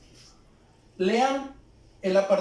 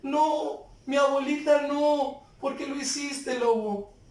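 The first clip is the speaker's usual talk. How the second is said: higher pitched, tearful pleading cry; 6 semitones higher than usual. Shown as a demonstration. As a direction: let the grief break through the words. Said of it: "Es muy diferente a que lo diga".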